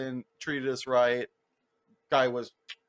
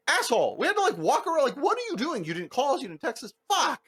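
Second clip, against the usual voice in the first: mock rant; 12 semitones higher, faster than usual. Rider venting at the late driver, high mock rant. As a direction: vent at him in a high pitched tirade.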